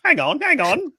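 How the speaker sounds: mocking very high interruption; Piers Morgan. Aping the interviewer in the clip, high and silly. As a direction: squeal it as a comic parody of an interrupting host. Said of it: "annoyed by with Piers Morgan".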